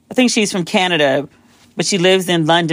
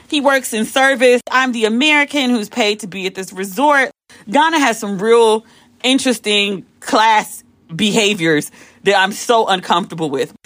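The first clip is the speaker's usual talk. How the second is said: usual speaking voice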